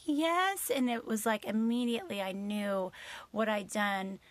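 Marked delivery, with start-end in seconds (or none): 0.1-0.7 sheepish high yes. Retold or imitated. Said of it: "I was like".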